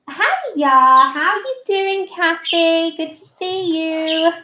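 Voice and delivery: high, drawn-out singsong hello; stretched, high-pitched welcome; friendly greeting. Real, not acted. Greeting a viewer joining the chat.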